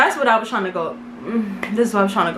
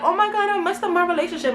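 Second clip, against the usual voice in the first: higher-pitched retold lament; mock distress; 6 semitones higher, 3 dB quieter, faster than usual. Woman mimicking her own upset over her relationship, mock distress. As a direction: re-enact a mildly distressed wail. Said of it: "feeling hella sad".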